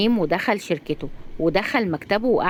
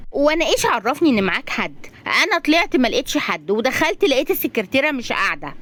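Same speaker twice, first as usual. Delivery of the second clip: higher female-character voice; pitched-up, protesting heroine; defensive young woman; 5 semitones higher than usual. Acted. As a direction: explain yourself in a high, lively voice. Razan voiced in story.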